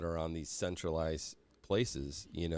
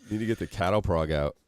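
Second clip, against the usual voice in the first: normal talking voice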